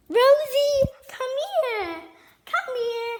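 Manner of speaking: high, loud call to the dog. Real, not acted. Girl calling her dog Rosie across the room, high and loud. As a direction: sing out the name to fetch her.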